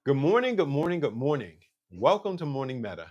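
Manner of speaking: usual speaking voice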